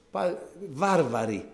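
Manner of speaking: ordinary talk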